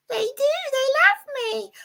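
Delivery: very high, squeaky puppet character lecturing. Acted. Puppet explains negative thinking to the viewers, very high squeaky voice. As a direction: pitch way up for the little puppet. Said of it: "it's a puppet show".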